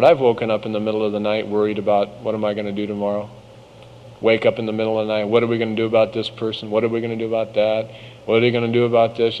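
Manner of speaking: fast, hushed run of worried questions; anxious night thoughts. Retold or imitated. Preacher imitating her own midnight worrying, fast and hushed. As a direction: rattle off the worries quickly under your breath.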